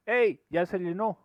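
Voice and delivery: high, loud call; shouting. Retold or imitated. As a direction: yell across that it is full. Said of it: "este de acá grita". (grito agudo y fuerte)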